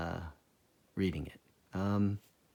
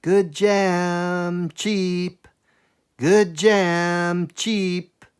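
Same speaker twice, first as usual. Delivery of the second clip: high sing-song street seller call; old woman's sing-song; 8 semitones higher, 9 dB louder, much slower than usual. Acted. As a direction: call out your wares like an old market woman. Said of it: "She sang out".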